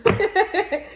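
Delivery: short high burst of laughter; a quick amused laugh. Real, not acted. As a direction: laugh at her own joke. Laughing at the Snickers joke.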